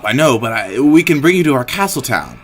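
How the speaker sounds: put-on loud, urgent plea to the old man; Susie pleading. Susie begs the dying old man, loud and urgent. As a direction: beg him to come along, loudly.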